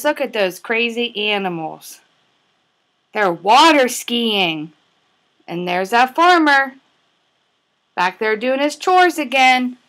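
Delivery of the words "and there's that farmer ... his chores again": plain reading voice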